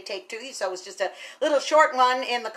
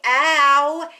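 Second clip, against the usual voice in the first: mock ow; 4 semitones higher, 8 dB louder than usual. Recalling the shot's pain.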